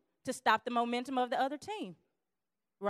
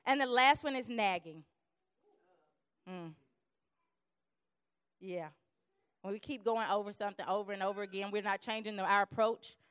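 ordinary talk

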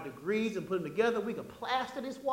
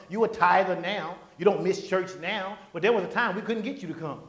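ordinary talk

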